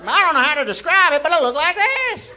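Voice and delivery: high, loud, rapid burst; hammed-up frantic yelling; frantic outburst. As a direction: gabble it at a comic shout. Acts out frantic description.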